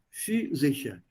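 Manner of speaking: few and sicher as two separate parts, few as fü; syllable by syllable. Shown as a demonstration. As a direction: demonstrate few, then sicher, slightly apart. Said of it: "So few and then sicher".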